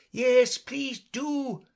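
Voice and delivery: slow, loud group call; chorus plea. A narrator's reading. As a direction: call out together, pleading. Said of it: "the others all joined in chorus".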